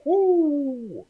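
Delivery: a high, loud "woo" whoop; imitated whoop. Describing the song's verses to his cohost, a high whooping "woo". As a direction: whoop it up like the song does.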